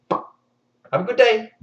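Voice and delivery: slow, bright mock-pleasant farewell; breezy villain send-off; sarcastic cheery sign-off. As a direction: wish her well after threatening her head. King's cheery sign-off to threat.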